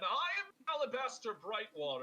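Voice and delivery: high, pompous self-announcement; grandiose introduction. Acted. Alabaster restarting his spiel to the bar, grandiose. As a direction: introduce yourself as someone important.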